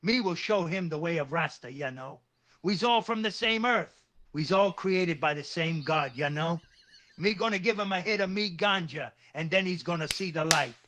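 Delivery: put-on Rasta lilt, Jamaican accent; Rastafari patois. Convincing upset Tony, mock Rasta patois. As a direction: win him over in easygoing Rasta patois. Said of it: "with a Rastafari patois".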